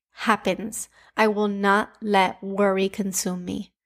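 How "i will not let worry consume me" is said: normal talking voice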